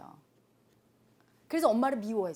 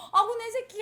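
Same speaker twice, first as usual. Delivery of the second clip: high, loud affectionate coo; doting mother; 11 semitones higher, 5 dB louder than usual. Retold or imitated. Mother scooping up her little son, doting coo. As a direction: gush over your child as you hug him.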